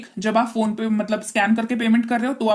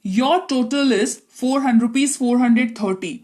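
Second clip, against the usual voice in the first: slow, careful teacher's read of the total; deliberately slow; much slower than usual. Shown as a demonstration. Teacher reading the ticket clerk's line to students, slowly. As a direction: spell it out slowly for learners. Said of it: "मैं धीरे बोल रही हूं".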